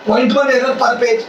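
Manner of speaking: slow dictation. Shown as a demonstration. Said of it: "तो बोल दूं".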